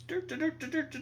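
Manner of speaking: high-pitched "dur-dur" noise; imitated hand noise. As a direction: chant a silly high sound effect for a prop. Player makes noise for hand.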